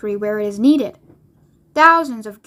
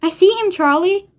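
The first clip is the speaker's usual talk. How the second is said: high, eager reply; storybook grandpa thrilled by the sight; excitedly; 7 semitones higher, 3 dB louder than usual. A narrator's reading. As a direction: answer with rising excitement. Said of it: "said Grandpa Joe excitedly".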